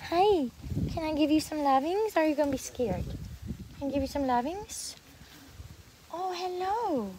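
high, soft pet talk; cooing to a cat. Real, not acted. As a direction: coax a shy kitty gently. Coaxing shy cats to be petted.

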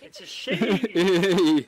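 very drawn-out, loud call; triumphant shout. Real, not acted. Player announces the ghost type to the team, shouting. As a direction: belt it out and stretch it long.

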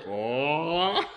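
low, drawn-out oh sliding up; swooning oh. Acted. Dirty Birdie thrown a kiss, long swooning oh. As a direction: let the oh swell slowly from low.

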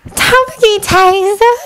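put-on high, slow, breathy girl's sigh; breathy swoon. A narrator's reading. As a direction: breathe his name, lovestruck. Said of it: "a girl breathed".

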